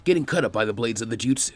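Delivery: usual speaking voice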